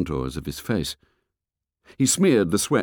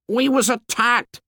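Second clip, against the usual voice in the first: put-on fat boy voice. A narrator's reading. Fat boy complains to the fair boy, high and whiny. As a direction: complain like a chubby schoolboy.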